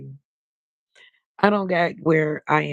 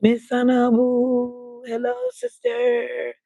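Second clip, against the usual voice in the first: high, slow drawn-out hello; singsong greeting; 7 semitones higher, much slower than usual. Real, not acted. Host greets a viewer joining the live stream, singsong.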